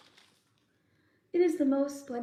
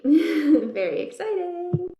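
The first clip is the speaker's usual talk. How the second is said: louder, slow, laughing delight; 4 semitones higher, 8 dB louder, slower than usual. Real, not acted. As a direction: laugh as you say it. Laughing about the peach.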